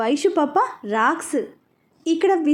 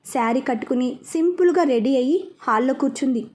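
ordinary delivery, nothing marked

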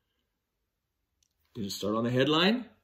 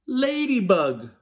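"Ladybug" higher, split into beats; slow syllabified "Ladybug"; 7 semitones higher, 3 dB louder, slower than usual. Shown as a demonstration. Teacher clapping syllables for children, slow "Ladybug". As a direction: spell out "Ladybug" slowly. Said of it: "clap the syllables in ladybug".

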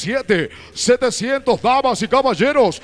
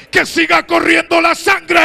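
shouted hype; 6 semitones higher than usual. Acted. Final hype line before fight.

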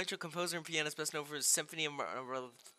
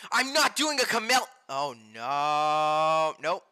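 loud protest; 9 dB louder, slower than usual. Real, not acted. Streamer refusing a song on stream, loud protest. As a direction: cry out in protest, then groan it out.

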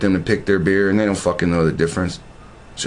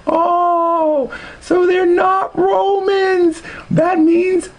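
very high, slow, whining mock cry; mock whiny voice; 4 dB louder, much slower than usual. Mocking the video's claim in a high whine. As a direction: squeak it out like a sulking know-it-all.

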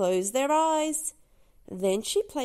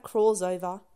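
plain reading voice

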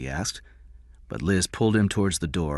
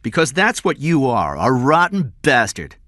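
loud, high-pitched angry insult; 8 semitones higher, 7 dB louder than usual. Acted. Detective insulting the man who refused to pay, angry. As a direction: spit out your contempt, louder. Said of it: "Corella said angrily".

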